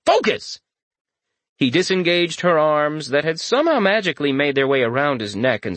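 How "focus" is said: much higher, sharp self-command; forceful inner command. A narrator's reading. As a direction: snap yourself back to focus. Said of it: "he thought to himself".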